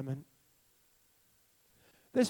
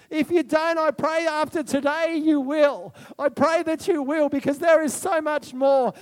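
louder, higher, driving preacher voice; fired-up preaching; 10 semitones higher, 9 dB louder than usual. Real, not acted. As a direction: rouse the congregation. Preaching at full force.

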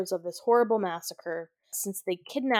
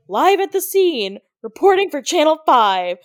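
higher, loud, bright broadcast voice; chipper news reporter; 6 semitones higher, 9 dB louder than usual. Retold or imitated. Gail reporting live from the scene, chirpy newscaster. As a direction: sign off like a TV anchor on air. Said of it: "is, like".

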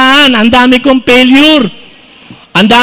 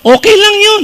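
okay lang yun jumped way up high; high mock reassurance; 11 semitones higher than usual. Preacher teasing the off-key singer in a high mock-comforting voice. Reassuring joke about bad singing.

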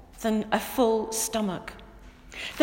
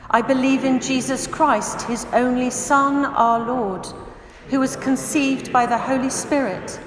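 loud, steady unison recitation of the creed; 5 dB louder than usual. Acted. Pastor leads the church in the Apostles' Creed, loud unison recitation. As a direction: declare the creed together with the congregation.

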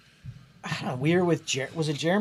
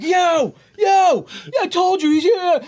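high, loud mimicked hollering; imitated excited yelling; 13 semitones higher, 6 dB louder, slower than usual. Friends FaceTiming the one who left, mimicked excited yelling.

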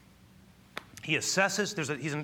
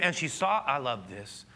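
usual speaking voice

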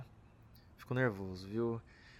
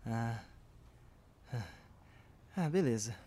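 ordinary delivery

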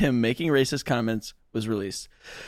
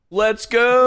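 amped up cheer; hyped; 9 semitones higher, 6 dB louder than usual. Real, not acted. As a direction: pump it up, high and loud. Hyped for Chrissy's court.

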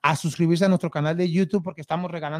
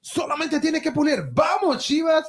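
promo shout; 8 semitones higher than usual. Acted. On-air promo call to comment.

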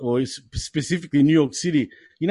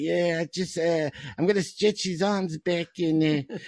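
put-on softer, halting old Canadian wrestler; Stu Hart; 5 dB quieter than usual. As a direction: mumble through it as a wrestling trainer, quietly. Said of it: "full-blown fucking Stu Hart".

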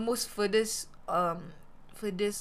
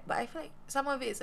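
normal talking voice

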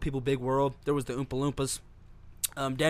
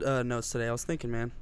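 ordinary talk

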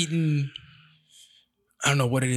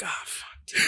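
exasperated whisper; 6 dB quieter than usual. Retold or imitated. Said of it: "I'm like".

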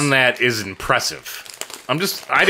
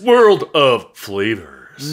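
very slow, drawn-out echo; mock announcer; 3 semitones lower, much slower than usual. Echoing co-host on second bag, drawn-out mock announcer. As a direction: stretch every word like a grand announcer.

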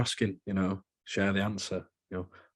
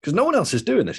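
excited emphasis; 11 semitones higher, 7 dB louder, faster than usual. Real, not acted. Hyping podcast to listeners.

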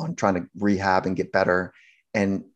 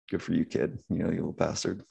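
grudging coach; 2 semitones lower, 8 dB quieter than usual. Retold or imitated. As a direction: say it quietly, half amused. Scarred coach grudgingly answers.

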